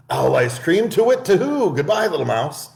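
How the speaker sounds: high, alarmed owl hooting off; panicked owl voice; startled owl. Acted. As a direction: owl in a fluster.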